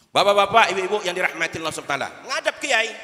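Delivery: loud, fast preacher's address; rousing shout to the jamaah; calling out to the crowd. Real, not acted. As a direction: boom it to wake the congregation.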